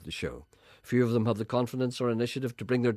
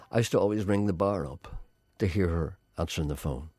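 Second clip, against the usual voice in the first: ordinary delivery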